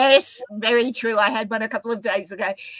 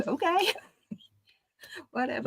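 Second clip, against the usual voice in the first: soft, higher, puzzled okay with a laugh; bemused acceptance; 7 semitones higher, 9 dB quieter, faster than usual. Retold or imitated. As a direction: shrug it off, amused. Her bemused reply, retold.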